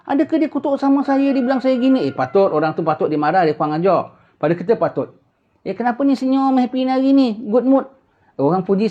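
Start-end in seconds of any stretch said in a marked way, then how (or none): 0.1-2.1 much higher, indignant questioning; offended asker. Acted. Acted offended person asking. 5.7-7.8 high, chirpy teasing voice; teasing friend. Acted. Acted friend teasing cheerful man.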